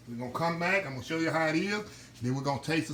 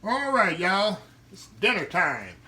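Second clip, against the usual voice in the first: calling out dinner time; 6 semitones higher, 6 dB louder, slower than usual. Real, not acted.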